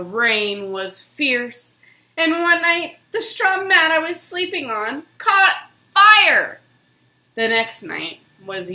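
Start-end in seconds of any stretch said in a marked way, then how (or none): 2.2-6.6 higher and louder, rising alarm. A narrator's reading. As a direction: tell it like sudden danger flaring up. Dramatic peril in narration.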